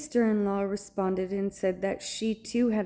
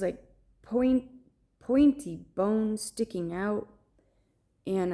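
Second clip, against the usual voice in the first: usual speaking voice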